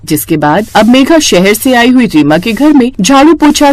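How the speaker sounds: plain reading voice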